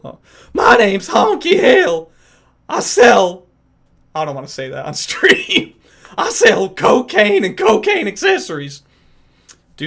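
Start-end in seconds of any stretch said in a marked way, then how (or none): 0.5-3.5 Honky Hill impression. Asked for Honky Hill, streamer introduces him high and loud. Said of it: "Do Honky Hill". 5.1-8.8 Honky Hill impression. Streamer finishes the Honky Hill line, laughing. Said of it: "Do Honky Hill".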